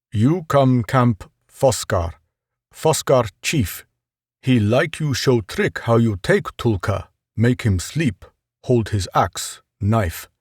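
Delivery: a lightly voiced tribesman inviting him to camp; tribesman's broken speech. Tribesman invites Ross to meet his chief, slow and halting.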